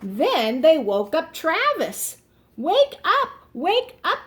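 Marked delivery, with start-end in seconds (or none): 2.6-4.2 high calling wake up; shouting. A narrator's reading. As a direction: call out to wake them. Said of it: "they shouted".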